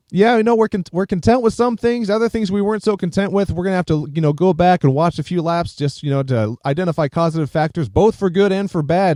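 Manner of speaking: high, mocking post-race interview voice; bland driver interview. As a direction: parody a dull driver interview. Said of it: "gave the most standard post-sports event interview ever".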